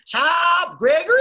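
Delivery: loud, high country twang calling out; country mom voice. Retold or imitated. Imitating a mother calling her son in a country accent. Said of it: "When I do my mother's voice".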